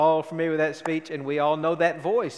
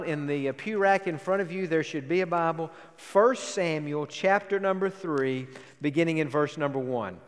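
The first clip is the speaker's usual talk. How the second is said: ordinary talk, nothing marked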